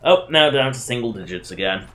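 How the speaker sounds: usual speaking voice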